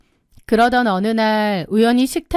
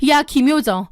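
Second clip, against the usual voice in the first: higher, heated scolding that builds; angry confrontation; 5 semitones higher than usual. Acted. Older sister phoning to confront her sibling, angrily scolding. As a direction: tear into her, voice rising as you go. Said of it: "동생에게 전화를 걸어 따졌습니다".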